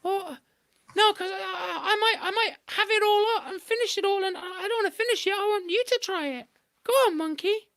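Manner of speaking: very high sweet wheedling; coaxing trickster. A narrator's reading. Anansi coaxing Monkey toward the honey tree, high and wheedling. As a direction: sell the honey hard.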